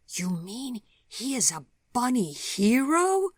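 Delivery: put-on high, loud, slow child's amazement; awed little girl; gasped. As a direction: gasp it out, wide-eyed child. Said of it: "she gasped".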